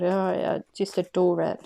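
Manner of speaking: ordinary talk, nothing marked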